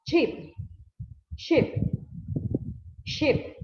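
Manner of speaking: sheep said high and clear, repeated; model pronunciation. Shown as a demonstration. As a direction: drill sheep for the class. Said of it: "El siguiente ejemplo tenemos". (repetir sheep claramente)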